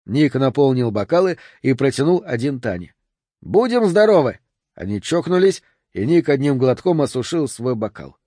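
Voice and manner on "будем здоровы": cheerful toast. A narrator's reading.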